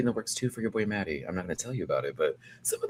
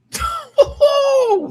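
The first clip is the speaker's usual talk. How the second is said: loud shrieking laugh; 11 dB louder than usual. Real, not acted. Laughs at own joke.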